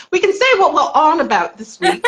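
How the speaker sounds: playfully borrowing a British friend's slang; mock British phrasing. Shown as a demonstration. Cohost wrapping up the stream, teasing with mock British phrasing. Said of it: "I'm trying to speak your language".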